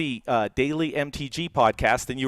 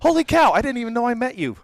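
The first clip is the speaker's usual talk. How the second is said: retold shock, high and loud then rushing on; big high-pitched "can't believe it" reaction; astonished exclamation; 9 semitones higher, 5 dB louder than usual. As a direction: blurt it out in mock amazement, pitch way up. Retelling own surprised reaction to photo.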